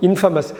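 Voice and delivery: இன்ஃபேமஸ் as 'IN-fuh-mus'; shortened pronunciation. Shown as a demonstration. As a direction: clip the middle of இன்ஃபேமஸ் to 'fa'. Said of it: "குறுக்கி சொல்லணும்". (இன்ஃபேமஸ் - 'இன்ஃபமஸ்' என்று குறுக்கி)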